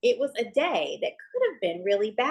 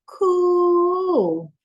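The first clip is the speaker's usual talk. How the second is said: higher, louder awestruck cool; awed; 8 semitones higher, 6 dB louder than usual. A narrator's reading. Narrator admiring the jumping bean, high awestruck. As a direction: gasp it in wonder.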